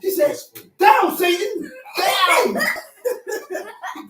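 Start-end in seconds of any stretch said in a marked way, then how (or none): 0.8-2.6 preacher's shout. Retold or imitated. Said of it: "he started shaking".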